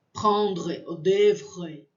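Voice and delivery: "prendre" and "devre" with guttural French R; R in the throat. Shown as a demonstration. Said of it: "eu sinto aqui embaixo".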